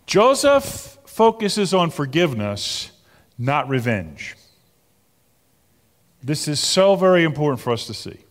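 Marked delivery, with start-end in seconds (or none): none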